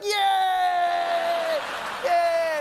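high, loud drawn-out cheer; delighted child. Acted. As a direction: cheer like a kid loving it. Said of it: "And I was like".

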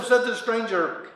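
ordinary delivery

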